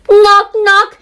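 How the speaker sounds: loud, high sung-out knock knock; knocking call. A narrator's reading. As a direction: knock on the door with your voice. Knocking sound read out loud.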